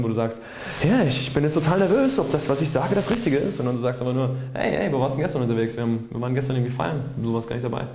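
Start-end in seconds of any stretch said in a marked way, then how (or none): 0.8-3.6 higher, unsure nervous voice; mock nervous. Shown as a demonstration. Talking to a close friend, wrongly nervous. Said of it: "hast du nicht diesen Tonfall drin". 4.5-7.9 quick, offhand chat between close friends; casual, everyday. Shown as a demonstration. Telling a friend about last night's party, casual. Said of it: "diese Gewöhnlichkeit in der Stimme".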